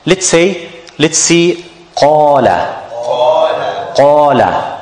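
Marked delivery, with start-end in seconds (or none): 2.0-4.7 qala with a heavy, full alif. Shown as a demonstration. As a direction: say qala with a deep, thick a. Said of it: "The alif here is mufakhama".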